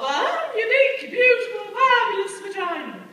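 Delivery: loud, high, sung-out rapture; hammed-up ecstatic proclamation; gushing workshop leader. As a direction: imitate the workshop leader exulting over each adjective. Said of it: "that's what she called it anyway".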